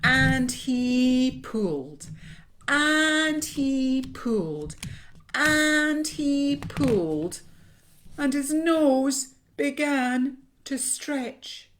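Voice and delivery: loud, high, slow chanted heave; laboured, repeated tugging; straining effort. A narrator's reading. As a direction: drag out each word as if hauling on a rope. Narrator acts out the straining pull.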